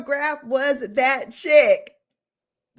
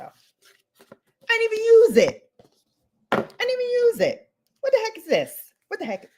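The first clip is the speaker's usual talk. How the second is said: mock whine; 11 semitones higher than usual. Whiny high complaint.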